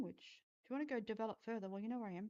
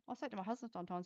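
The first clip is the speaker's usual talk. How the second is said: normal talking voice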